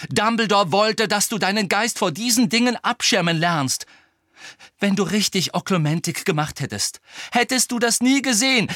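heated, reproachful argument; grim insistence. A narrator's reading. Hermine reproaching Harry about Oklumentik, grim and heated. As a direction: press the point grimly. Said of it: "rief Hermine grimmig".

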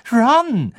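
high, loud reproach; reproachful. A narrator's reading. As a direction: call the name out in sharp rebuke. Hermine weist Ron scharf zurecht. (vorwurfsvoll ermahnend)

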